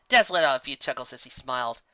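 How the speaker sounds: ordinary delivery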